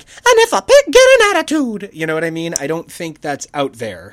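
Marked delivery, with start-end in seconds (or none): 0.0-1.9 high, loud squeaky cartoon mouse voice; Mickey Mouse. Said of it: "Mickey being like".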